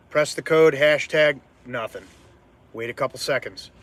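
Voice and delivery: normal talking voice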